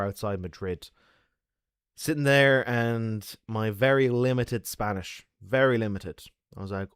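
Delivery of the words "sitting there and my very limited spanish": ordinary delivery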